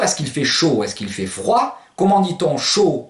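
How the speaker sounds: usual speaking voice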